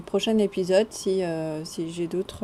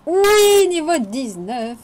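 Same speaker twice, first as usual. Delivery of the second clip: loud high cheer, then slower; real joyful whoop; triumphant yes; 7 semitones higher, 10 dB louder, slower than usual. Real, not acted. Gets wanted enchant.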